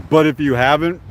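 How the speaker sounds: normal talking voice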